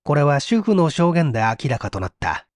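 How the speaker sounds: plain reading voice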